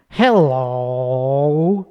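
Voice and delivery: slow, sultry "Hello" swooping across an octave; seductive hello. Shown as a demonstration. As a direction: say "hello" like you're trying to get laid. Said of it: "That's a very sexual way".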